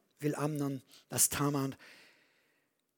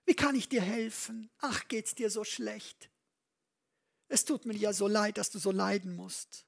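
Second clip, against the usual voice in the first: zärtlicher Vater, deutlich höher gesprochen; besorgter Vater; 6 semitones higher than usual. Retold or imitated. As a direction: wie ein übertrieben besorgter Papa, höher und sanfter. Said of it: "da hat er ihn gefragt". (gespielt liebevoller Vater, höher)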